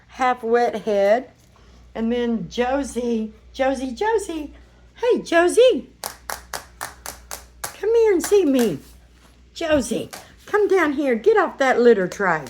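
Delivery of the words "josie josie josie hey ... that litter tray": calling a puppy. Real, not acted. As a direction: call the puppy over sweetly.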